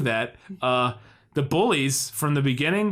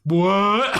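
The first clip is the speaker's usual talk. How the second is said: laughing surprise; 4 semitones higher, 5 dB louder than usual. Real, not acted.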